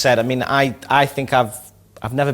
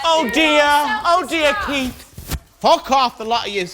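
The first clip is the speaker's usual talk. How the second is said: rowdy jeering pub banter; 12 semitones higher than usual. Real, not acted. Drunken jeering in clip.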